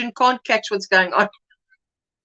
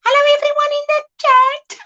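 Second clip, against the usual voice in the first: teasing voice; 4 dB louder than usual. Retold or imitated. Teasing, voicing daughter Jessica.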